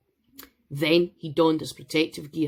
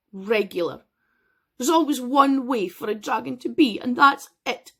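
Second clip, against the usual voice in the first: higher, complaining dragon voice; weary dragon; 7 semitones higher, 4 dB louder than usual. Acted. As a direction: pour out a tired beast's grievances.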